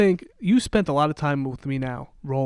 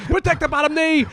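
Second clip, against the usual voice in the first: high, loud shout of instructions; mock coaching yell; 12 semitones higher, 5 dB louder than usual. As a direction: yell it like a coach to a fighter, playfully. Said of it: "you're just gonna start yelling to the other guy".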